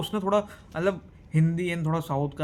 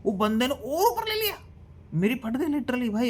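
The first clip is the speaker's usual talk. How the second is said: panicked telling; 8 semitones higher than usual. Retold or imitated. Plane climbs instead of landing.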